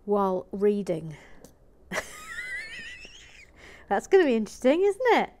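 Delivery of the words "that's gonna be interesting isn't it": laughing. Real, not acted. Laughing at the reader.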